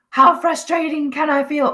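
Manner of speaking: a hammed-up fed-up self; mock frustration. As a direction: wind yourself up, half playful. Acting out her own frustration.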